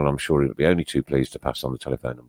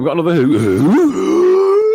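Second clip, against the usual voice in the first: excited; 12 semitones higher, 9 dB louder, slower than usual. Real, not acted. Excited quiz call, high and loud.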